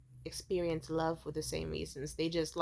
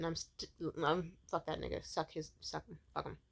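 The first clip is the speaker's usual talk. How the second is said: normal talking voice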